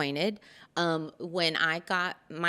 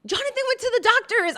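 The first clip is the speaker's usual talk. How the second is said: much higher, quick and loud; high fast retort; 7 dB louder, faster than usual. Retold or imitated. Daughter explains to her father, high and quick. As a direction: rattle it off high. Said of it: "And I'm like".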